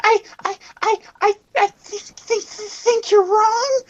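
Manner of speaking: high, timid, stammering squeak; mocking shy stutter. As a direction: stutter like a terrified shy girl. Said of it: "mocked her for it".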